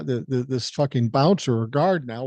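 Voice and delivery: usual speaking voice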